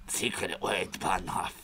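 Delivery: whispered secret word, Bahnhof. Shown as a demonstration.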